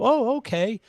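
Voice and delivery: imitating startled shop staff; hint of surprise; mock surprised okay.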